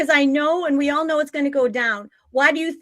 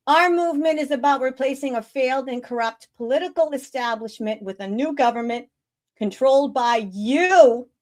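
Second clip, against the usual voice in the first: usual speaking voice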